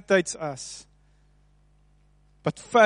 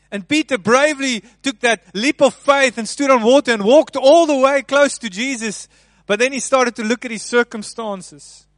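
loud, higher building sermon; preaching at full force; 6 semitones higher, 9 dB louder than usual. Acted. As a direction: swell with the story of the leap of faith. Dramatic telling of Peter walking.

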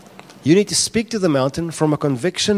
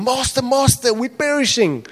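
high, frantic cry for help; panicked disciples; 10 semitones higher than usual. Acted. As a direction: cry out in terror.

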